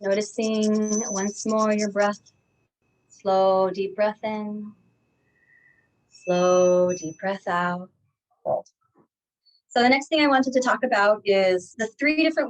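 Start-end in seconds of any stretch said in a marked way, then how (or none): 0.0-8.6 guided breathing. Acted. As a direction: ease them into slow breathing. Leads a breathing exercise.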